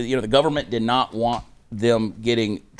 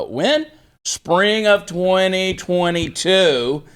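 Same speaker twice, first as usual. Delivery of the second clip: mock surprise; 7 semitones higher, much slower than usual. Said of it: "mocking rhetorical question".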